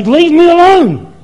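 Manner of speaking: shouted high and slow, fed up; a sharp, high, angry plea; angry outburst. Retold or imitated. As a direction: yell it at God to back off. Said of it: "and I would get angry".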